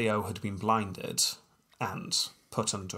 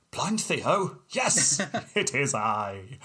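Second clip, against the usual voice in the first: pompous vain hero; 4 semitones higher, much slower than usual. Pretend. Acted gasp and reveal.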